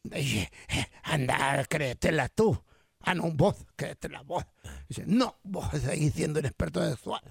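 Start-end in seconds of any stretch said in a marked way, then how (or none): 0.7-4.4 put-on Salvadoran accent. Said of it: "¿De dónde es ese acento?". 5.1-7.2 low, soft Salvadoran retort; Salvadoran accent. Acted. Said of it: "¿De dónde es ese acento?".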